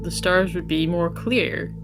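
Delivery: normal talking voice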